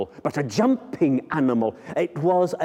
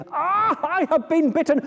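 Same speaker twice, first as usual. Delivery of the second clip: mock pained king; faster than usual. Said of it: "without the king saying".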